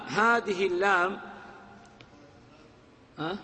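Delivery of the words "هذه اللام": ordinary talk, nothing marked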